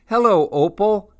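high, loud greeting; delighted hello, pitched well up; welcoming elephant baker. A narrator's reading. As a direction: sing out a welcome.

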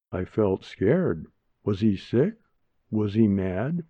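ordinary delivery

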